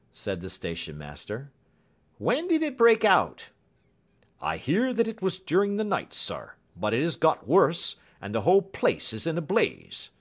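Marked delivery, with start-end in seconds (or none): 2.2-3.5 high, loud questioning; raised, pressing inspector voice; urgent question. A narrator's reading. Urgent question about the fire. 4.4-10.1 high, loud station master voice; animated, raised-pitch railway man. A narrator's reading.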